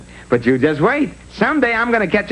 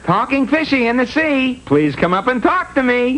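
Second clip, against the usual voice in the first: calling out; 7 semitones higher than usual. Acted. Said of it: "called out loudly".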